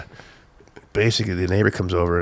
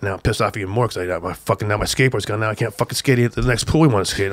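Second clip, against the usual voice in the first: ordinary talk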